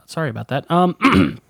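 normal talking voice

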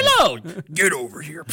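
loud mock fighting-game catchphrase; Scorpion. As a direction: yell it like a game fighter reeling someone in. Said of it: "Featuring Scorpion".

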